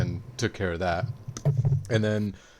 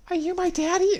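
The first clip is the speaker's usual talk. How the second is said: much higher, squeaky disbelieving question; shocked girl. Retold or imitated. Daughter discovering her father, squeaky shock. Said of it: "the girl's like".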